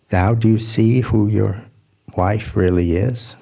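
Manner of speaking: plain reading voice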